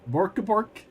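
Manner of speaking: playful Muppet chef bork; Swedish Chef. Pretend. Streamer doing Swedish Chef for chat, playful. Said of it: "my Swedish Chef impersonation".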